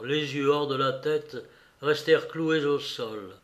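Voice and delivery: usual speaking voice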